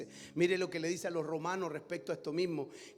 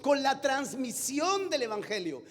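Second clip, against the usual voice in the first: raised, emphatic pulpit voice; impassioned preaching; 6 semitones higher, 6 dB louder than usual. Acted. Preacher urging his congregation, emphatic and raised. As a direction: drive the point home to the church.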